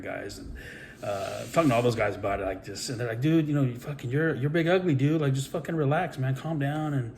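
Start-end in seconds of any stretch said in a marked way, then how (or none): 3.2-6.9 friends reassuring him. Retold or imitated. Friends calming him before match.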